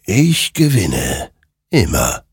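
slow, otherworldly rider voice; a lightly voiced murmur of victory. A narrator's reading. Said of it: "murmelte dieser". (langsam, leise angedeutet)

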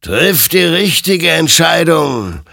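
loud, high, drawn-out command; booming warning from an apparition; stern admonition. A narrator's reading. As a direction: admonish forcefully, stretching the words. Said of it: "ermahnte ihn sein Gegenüber".

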